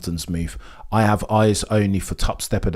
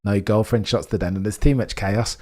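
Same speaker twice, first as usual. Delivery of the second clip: ordinary talk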